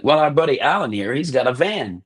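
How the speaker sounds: new friends pitching a trip to Spain, eager; upbeat offer. Retold or imitated. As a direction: sell the road trip with enthusiasm. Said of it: "And they're like".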